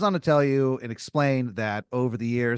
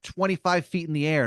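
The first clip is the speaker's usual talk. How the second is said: boastful; 6 semitones higher than usual. Acted. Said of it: "He's like".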